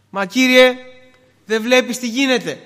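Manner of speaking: high, loud cry to God; desperate plea. Acted. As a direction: call out in desperate protest. Disciples' desperate cry to the Lord.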